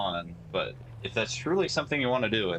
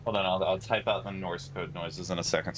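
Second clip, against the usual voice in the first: ordinary delivery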